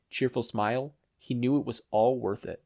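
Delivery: ordinary delivery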